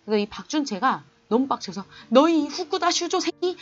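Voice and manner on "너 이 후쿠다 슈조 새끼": higher, louder, slow angry shout; furious yell. Retold or imitated. Cousin shouts at Fukuda for harassing girls, furious yell. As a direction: yell at him like an outraged cousin.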